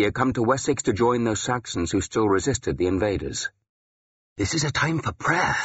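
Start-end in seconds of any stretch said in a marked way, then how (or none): 4.4-5.6 higher-pitched, insistent urging. A narrator's reading. Priest urging a quarrelsome man to pray, insistent. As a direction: press the point in a raised pitch. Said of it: "he insisted".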